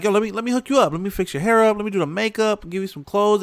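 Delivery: high-pitched put-on girl voice; hammed-up girl offering a makeover; imitated bar girls. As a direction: play the chatty girls, pitched well up. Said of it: "and they're like".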